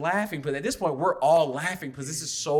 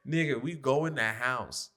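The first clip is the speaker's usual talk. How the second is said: ordinary talk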